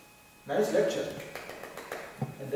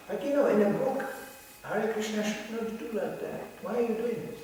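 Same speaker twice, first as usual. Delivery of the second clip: challenging questioner; 7 semitones higher than usual. Retold or imitated. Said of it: "you have a full right to ask".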